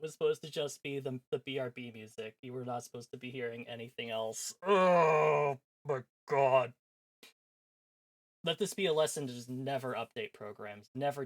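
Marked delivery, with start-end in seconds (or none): 4.7-6.7 long drawn-out loud groan of oh my god; exasperated shout. Real, not acted. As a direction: let it out slowly, fed up. Exasperated at plugin mistake.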